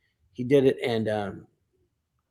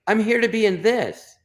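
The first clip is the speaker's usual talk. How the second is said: raised, higher-pitched indignant protest; indignant retort; 8 semitones higher, 4 dB louder than usual. Retold or imitated. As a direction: push back, indignant, pitch jumping up. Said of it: "So I was pretty pissed".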